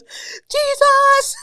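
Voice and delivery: high, loud shriek of fright; terrified scream. Retold or imitated. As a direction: shriek like something grabbed you in the dark. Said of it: "he goes".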